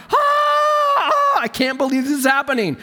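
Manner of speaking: imitating his own horror; alarmed wail of disbelief; panicked cry. Snake bite, panicked retold reaction.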